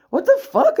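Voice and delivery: high, loud shocked cry; retold shocked outburst; outraged disbelief. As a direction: blurt it out, stunned and offended. Retold reaction to Rita's accusation.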